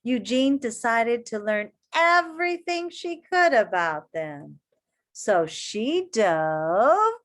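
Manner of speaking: put-on reading to children. A narrator's reading. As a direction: read it to a circle of kids. Reading picture book aloud.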